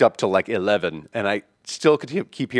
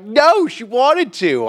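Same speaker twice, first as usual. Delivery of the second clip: high, drawn-out protest; acted-out indignant comeback; indignant retort; 11 semitones higher, 4 dB louder, much slower than usual. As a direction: protest it slowly, pitched way up.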